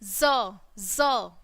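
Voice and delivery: ज said low and short, twice; teacher drilling the Z sound as ज; phonics sound. Shown as a demonstration. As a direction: sound out ज for the class. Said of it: "क्या बोलेंगे".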